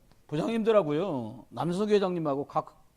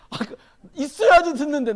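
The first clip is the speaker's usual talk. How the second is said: rueful laugh; 8 semitones higher, 8 dB louder than usual. Real, not acted. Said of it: "laughing regret at own failure".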